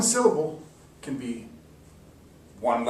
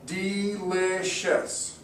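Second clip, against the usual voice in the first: "Delicious" very slow, "De-li-cious"; slow syllable split; 3 semitones higher, much slower than usual. Shown as a demonstration. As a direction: spell out "De… li… cious" in beats. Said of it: "we'll try one more".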